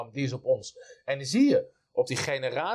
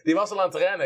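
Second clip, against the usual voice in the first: normal talking voice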